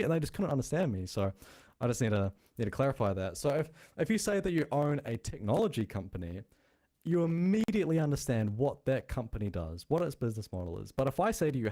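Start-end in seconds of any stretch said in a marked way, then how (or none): none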